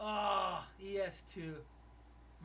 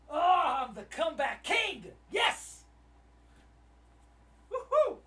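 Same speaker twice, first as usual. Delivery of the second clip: loud, high triumphant boast; real victory shout; very excited; 8 semitones higher, 12 dB louder than usual. Real, not acted. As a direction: crow it like a champion. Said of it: "I'm just so freaking excited".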